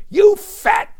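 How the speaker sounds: very high, loud screamed insult; full-voiced, high-pitched jeer; screaming heckler. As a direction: scream it like a booing crowd member.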